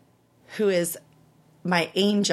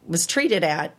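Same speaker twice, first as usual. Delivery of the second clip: usual speaking voice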